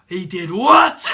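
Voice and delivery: booming, incredulous shout; flabbergasted. Retold or imitated. Parents hearing of the instructor's swearing, flabbergasted acted shout. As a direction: imitate outraged parents, exploding on the last word. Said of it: "were fucking flabbergasted".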